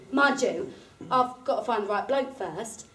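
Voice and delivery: ordinary talk, nothing marked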